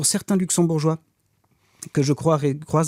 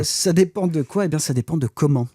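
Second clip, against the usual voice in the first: normal talking voice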